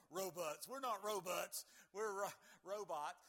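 mock a little kid playing robots; lightly voiced child repeating robots. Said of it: "When I was a kid, I remember going".

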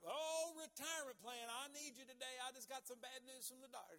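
high, hammed-up plea; loud opening wail easing into pleading; mock calling out. Preacher mimicking someone begging a retirement plan for help, mock desperate plea. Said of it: "When they call out, say".

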